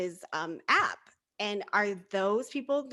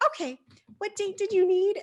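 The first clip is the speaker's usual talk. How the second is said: clerk asking; 11 semitones higher than usual. Retold or imitated. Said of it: "finally she's like".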